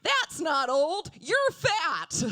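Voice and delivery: loud, high heckler jab; mock heckler shout; heckler yell. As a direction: shout it from the crowd. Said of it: "someone in the crowd yelled".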